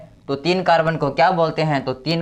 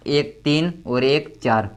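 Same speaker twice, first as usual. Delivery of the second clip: slow counting; slower than usual. Shown as a demonstration. Teacher counting valences on board.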